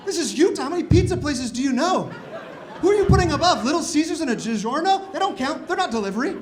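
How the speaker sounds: high, loud incredulous rant; arguing over pizza rankings in outrage; mock outrage. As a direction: rant in comic disbelief at his friend.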